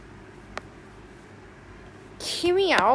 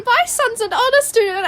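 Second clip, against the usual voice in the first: high, loud meme quote; meme shouting; 10 semitones higher, 5 dB louder than usual. Acted. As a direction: belt out the meme line in a raised voice. Acting out screaming meme, excited.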